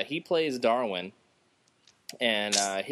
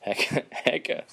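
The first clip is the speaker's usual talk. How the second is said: laughing Hecka; 3 semitones higher than usual. Real, not acted. Repeating slang laughing.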